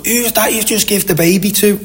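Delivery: high-pitched grandmother voice; imitated grandmother. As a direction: put on a high voice, like a questioning grandmother. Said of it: "next thing the grandmother or something".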